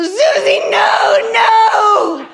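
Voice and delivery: put-on high panicked child scream, no no. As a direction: shriek like a terrified three-year-old. Child screaming at scary sister.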